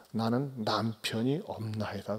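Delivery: the woman confessing to Jesus, slow and hushed; hushed confession. Retold or imitated. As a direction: let the confession drop to a hush.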